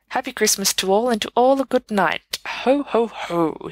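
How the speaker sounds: a hint of Father Christmas with a ho-ho-ho; jolly Santa. Dumbledore to the crowd, lightly voiced jolly Santa.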